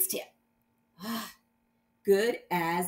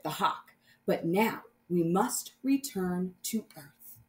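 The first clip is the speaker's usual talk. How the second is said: dramatic story reading; 4 dB louder than usual. A narrator's reading. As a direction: read it like a space adventure for kids. Teacher reads the story aloud.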